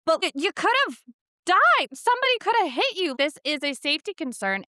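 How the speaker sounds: high, loud, frantic re-enacted scolding; alarmed scolding. Parent scolding child who ran into parking lot, alarmed. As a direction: pitch leaps up in alarm at the danger.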